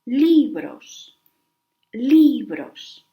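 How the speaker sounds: "Libros" with a loud, drawn-out first syllable, LI-bros; Spanish teacher modelling "Libros", stress up front; penultimate stress. Shown as a demonstration. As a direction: punch the LI of "Libros", then let "bros" fall away. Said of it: "the stress goes in the penultimate syllable".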